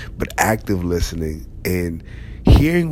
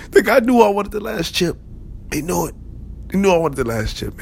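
much higher, hammed-up whine; indignant shopper; 12 semitones higher than usual. Hammed-up shopper venting about the chip thief. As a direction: complain like a cheated kid.

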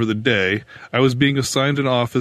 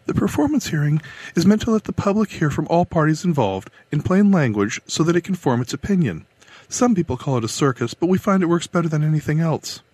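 higher, businesslike official briefing; brisk woman clerk; 7 semitones higher than usual. A narrator's reading. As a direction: lay out the rules briskly.

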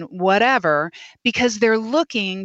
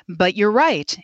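ordinary delivery

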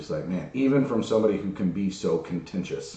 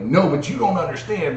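frustrated protest; 8 semitones higher, 4 dB louder than usual. Retold or imitated. Retold argument, frustrated.

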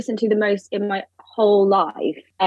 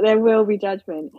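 usual speaking voice

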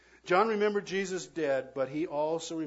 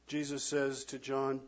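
ordinary talk

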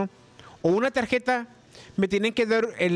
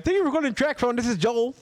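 bright, higher American call center greeting; mock American phone agent, higher pitch; gringo call center voice; 4 semitones higher than usual. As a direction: put on a cheery gringo agent accent. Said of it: "Gringo, de call center".

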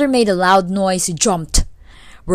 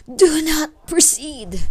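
put-on high, slow old woman's voice; slow, high-pitched warning from an old woman; 8 semitones higher, slower than usual. Old woman warns the hero.

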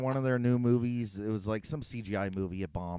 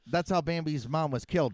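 ordinary talk

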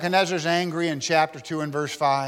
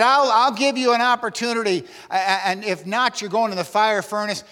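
raised-pitch acted royal threat; Nebuchadnezzar threatening; 5 semitones higher than usual. King threatening the men with the furnace, acted anger. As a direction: warn them as an angry king. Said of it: "He said".

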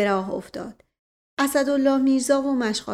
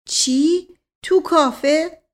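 higher, louder, drawn-out disbelief; astonished question; 7 semitones higher, 7 dB louder, much slower than usual. A narrator's reading. Listeners react to the sister singing in a café, astonished. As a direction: echo it back in loud, slow surprise. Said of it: "تقریباً همه با هم پرسیدن".

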